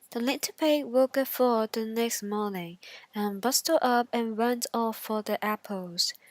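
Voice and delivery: usual speaking voice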